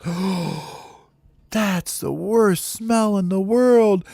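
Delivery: high, slow wail after a gasp; appalled counselor. Acted. Counselor walks into the smelly cabin, appalled wail. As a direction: wail as the stink hits you.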